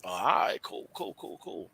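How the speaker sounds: low murmured acknowledgement, trailing into whisper; unbothered onlooker. Retold or imitated. Podcast guest acting out quietly watching gossipers make fools of themselves, unbothered murmur. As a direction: play it cool, fading almost to nothing. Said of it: "just watching the show like".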